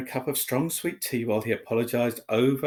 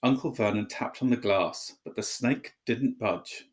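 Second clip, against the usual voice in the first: ordinary delivery, nothing marked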